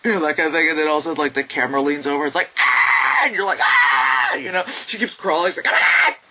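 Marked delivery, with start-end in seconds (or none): 2.6-3.2 loud unvoiced mock screech; sudden imitated scare noise; jump-scare scream. 3.6-4.4 loud mock yell of fright; startled acted scream; viewer's scream. 5.7-6.1 short loud mock screech; harsh acted cry; imitated scream. Imitating crawling woman.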